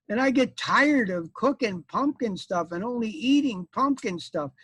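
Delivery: high put-on voice of a fed-up wife; complaining wife. Wife explains Peter's eating to the knight, high-voiced complaint. Plays the pumpkin eater's wife.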